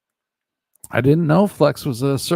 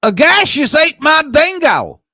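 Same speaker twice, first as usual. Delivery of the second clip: loud, high theatrical proclamation; dramatic shout; 12 dB louder than usual. Pretend. Streamer shouting a viewer's chat message aloud. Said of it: "I gotta read this right".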